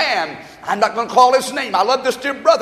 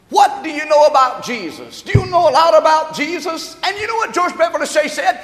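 raised, emphatic pulpit testimony; impassioned preaching; 6 semitones higher, 4 dB louder than usual. Real, not acted. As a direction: drive the testimony home to the congregation.

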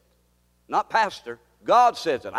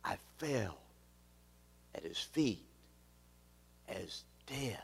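lower, softer, almost gone; fading hush; 5 semitones lower, 14 dB quieter, slower than usual. Acted. Pastor repeating John's collapse to his church, fading hush. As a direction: let it die away to a breath.

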